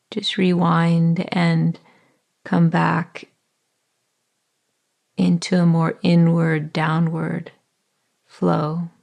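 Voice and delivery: calm body-scan voice with long pauses; guided meditation. Acted. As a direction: ease the listener into their body, unhurried.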